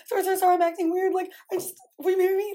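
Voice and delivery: high, fast, flustered proposal; imitating a nervous man proposing; nervous blurt. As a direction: rush it out, panicking. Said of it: "proposed 'cause he was so nervous".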